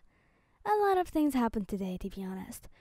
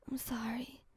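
softer, feeble murmur; weak apology; 8 dB quieter than usual. A narrator's reading. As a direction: say it faintly, with little strength. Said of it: "you say weakly".

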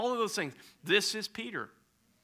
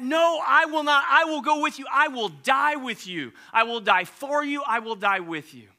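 loud, higher, insistent; fervent vow; 9 semitones higher, 8 dB louder than usual. Acted. Peter protesting to Jesus, loud fervent vow. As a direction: pledge with all heart.

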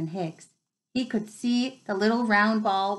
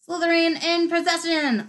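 lively commentator call, pitched higher; match commentator; 8 semitones higher, 4 dB louder than usual. Acted. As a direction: announce it like a schoolboy commentator. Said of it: "Lee Jordan was saying".

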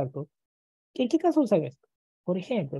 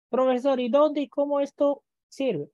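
imitating a student; 6 semitones higher than usual. Teacher voices an impatient student.

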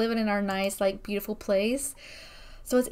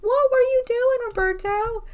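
high, loud what were you doing; mock-scold him, pitched way up; exasperated question; 12 semitones higher, 4 dB louder than usual. Teasing the husband for missing it, high loud mock scold. Scolding sim who missed it.

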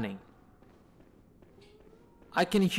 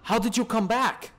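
shocked yell; 9 semitones higher than usual. Real, not acted. Enemy returns, startled outburst.

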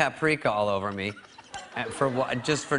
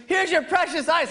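much higher, louder sneering taunt; mocking taunt; 4 dB louder than usual. Brother mocks the pledge, handing him ice. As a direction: sneer as you hand over the ice. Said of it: "And he goes".

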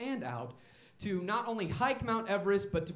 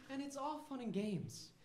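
ordinary talk

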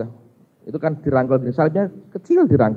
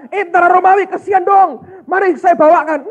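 mock eager shout; 12 semitones higher, 6 dB louder than usual. Simon's imagined cheerful offer.

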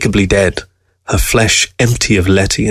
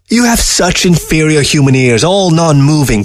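higher-pitched peevish fox; complaining; 6 semitones higher than usual. A narrator's reading. Fox frustrated with human ears, complaining. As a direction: grumble it as a fussy complaint. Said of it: "complained Fox".

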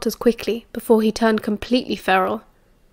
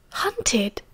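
soft whispered echo; hushed disbelief; 9 dB quieter than usual. Acted. Sirius reacts to hearing they hunted, hushed disbelief. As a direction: whisper it back as a startled question.

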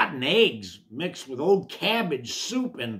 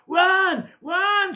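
high, loud warthog scream; panicked scream; 9 dB louder than usual. A narrator's reading. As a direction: scream the alarm at full pitch. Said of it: "screamed Warthog".

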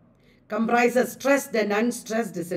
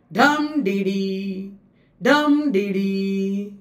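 slow dum dee dee, DUM punched, dee dee lower and held; dactylic beat; 3 semitones lower, slower than usual. Shown as a demonstration. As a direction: chant dum dee dee, weight on the first beat. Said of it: "Stress, unstressed, unstressed".

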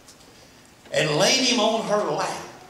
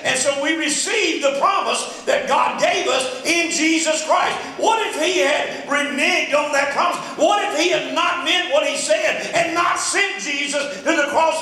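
higher and louder, building to shouted peaks; full-force preaching; 7 semitones higher, 5 dB louder than usual. Acted. Preacher to his congregation about God's promise, building to a full preaching shout.

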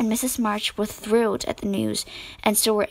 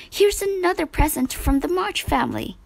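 a high, excited announcement; excited cry; 7 semitones higher than usual. A narrator's reading. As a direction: call out the surprise, pitched up high. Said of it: "he cried".

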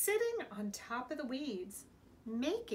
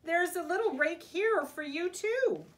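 high, loud coaxing call; 7 semitones higher, 5 dB louder than usual. A narrator's reading. Big Mouse offering Little Mouse a rake, calling out. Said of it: "he called".

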